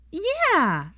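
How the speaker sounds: put-on high, loud boyish yell of joy; excited shout. A narrator's reading. Teddy cheering at the Christmas invitation, excited boy. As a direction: bounce with excitement. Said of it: "said Teddy excitedly".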